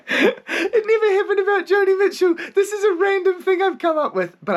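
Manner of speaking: high, laughing admission; giggling through the words. Real, not acted. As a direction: crack up while confessing it was invented. Laughs at his own made-up story.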